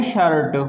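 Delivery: शर्ट said "shərt", short vowel, falling tune; शर्ट: short "ur" vowel, then a crisp ट; example word. Shown as a demonstration. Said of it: "ये क्या है". (शर्ट का सही उच्चारण)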